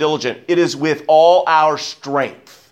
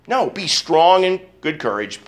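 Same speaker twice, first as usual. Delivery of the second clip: usual speaking voice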